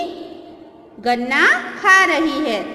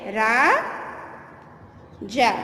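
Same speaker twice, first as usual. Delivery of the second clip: dictation drawl; 3 semitones lower, 3 dB quieter, much slower than usual. Shown as a demonstration. Teacher dictates Raja to pupils, very slowly stretched. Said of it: "तो लिखोगे".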